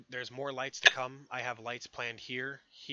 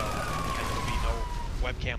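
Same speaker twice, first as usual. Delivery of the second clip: ordinary delivery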